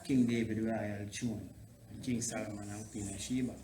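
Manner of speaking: ordinary talk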